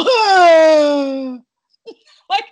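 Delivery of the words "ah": sharp high yelp of pain; sudden cry out. Retold or imitated. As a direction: let out a sudden loud yelp. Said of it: "it would just go".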